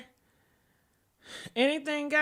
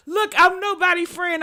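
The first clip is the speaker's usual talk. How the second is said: usual speaking voice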